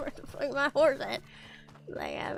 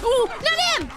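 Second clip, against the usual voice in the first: startled yelp; 10 semitones higher, 7 dB louder, slower than usual. Real, not acted. Startled by an attacker in game.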